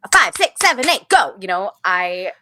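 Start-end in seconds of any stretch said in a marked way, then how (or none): 0.0-1.3 higher, louder choreographer's count-in; mock dance count. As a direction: bark out the count like a dance captain. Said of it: "not with the pressure of".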